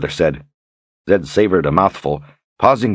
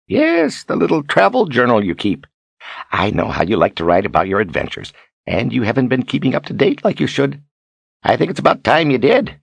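higher, weighted hinting in character; Zed, pointedly coaxing; 7 semitones higher than usual. Acted. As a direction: nudge her to take the hint.

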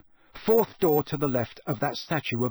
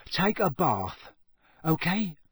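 drawn-out, higher hint; slow suggestion; 4 semitones higher, slower than usual. Acted. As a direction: offer an odd tip slowly. Hesitant advice, then repeated.

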